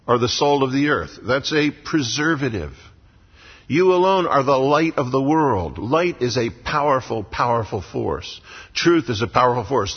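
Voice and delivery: ordinary talk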